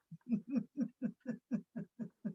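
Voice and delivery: warm chuckle at her own story; soft amused laugh. Real, not acted. Ends her parrot story.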